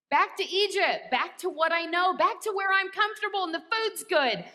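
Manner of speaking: whiny longing. Retold or imitated. Longing to retreat to comfort.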